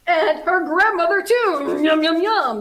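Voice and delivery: greedy wolf relishing dinner; hungry relish. A narrator's reading. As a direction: smack your lips over it.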